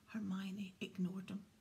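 plain reading voice

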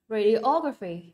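"Radiography" slowly, stressed on OG; slow "Radiography", weight on OG, then fall; third-syllable stress. Shown as a demonstration. Teacher models the word for students, stress before the graphy ending. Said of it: "âm số ba ha".

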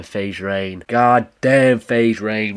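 usual speaking voice

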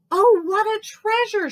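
high, loud delighted exclamation; exclaiming. Acted. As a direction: gasp it out with joy. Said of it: "she exclaimed".

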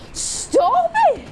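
very high, loud shriek; exasperated squeal. Real, not acted. Player under attack in game, high exasperated shout. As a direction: squeal in protest.

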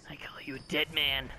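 hissed threat; menacing whisper. Acted. Gamer threatens a player who called him a rat, menacing whisper. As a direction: breathe the threat like a villain. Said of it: "I really need to stop doing that".

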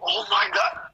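high, panicked yelp; scared shriek. Real, not acted. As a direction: shriek when the monster appears. Sees the monster.

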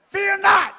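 high, loud command; commanding shout. Acted. Preacher commanding the congregation, loud and high. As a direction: order them not to fear. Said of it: "It's a command".

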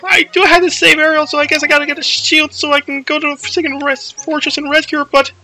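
high, worried babbling; anxious fretting. Acted. Frets about getting a shield.

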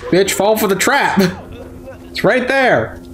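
high pitched frustrated yell at the enemy. Real, not acted. Player yelling at an enemy who won't fall for the trap, exasperated. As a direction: whine it out, exasperated.

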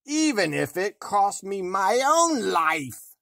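high, loud, slow shriek of rage; furious cry. A narrator's reading. Queen screaming her vow in rage, loud and high. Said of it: "cried she".

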